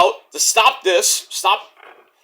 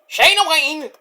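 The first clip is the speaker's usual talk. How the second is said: playful name; 8 semitones higher than usual. Pretend. Trying out the mom's name, playful name. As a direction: lift the whole of Shayna Wayne high. Playing with a newly learned name.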